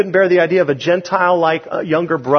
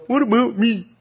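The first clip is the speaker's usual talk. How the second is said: a light, higher mock whine; 7 semitones higher than usual. Preacher mimicking his own jealous heart, light whine. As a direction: sulk it like a jealous child, lightly. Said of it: "and I'm going".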